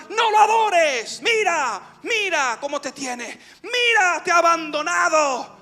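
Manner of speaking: high, loud taunting cries; shouting demons. Retold or imitated. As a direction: jeer and goad, drawing out the first words. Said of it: "Los demonios me gritaban". (gritos agudos de demonios acusadores)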